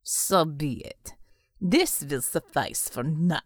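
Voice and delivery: low, slow and menacing, lordly; a dark master giving orders, low and slow; cold villain voice. Acted. Villain replies to devil.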